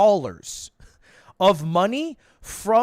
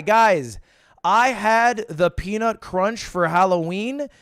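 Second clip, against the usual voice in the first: ordinary talk, nothing marked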